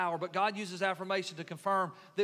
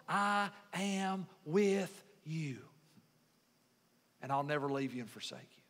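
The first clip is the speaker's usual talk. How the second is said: tender promise; 5 semitones lower, 4 dB quieter than usual. Acted. Preacher voices God's promise to the lonely, tender promise. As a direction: weigh each word, then drop low and soft.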